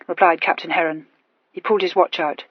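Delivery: ordinary delivery, nothing marked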